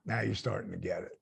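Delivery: quiet confiding line. Retold or imitated. He leaned over and he goes.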